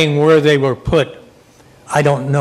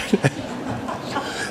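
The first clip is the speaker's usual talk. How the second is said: soft trailing chuckle; short chuckle; 11 dB quieter than usual. Real, not acted. Moderator still amused by the comeback, soft chuckle. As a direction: chuckle quietly to yourself.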